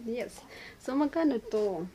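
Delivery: ordinary talk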